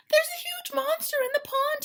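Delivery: high, loud panicked frog voice; frightened cry. Acted. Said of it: "he cried".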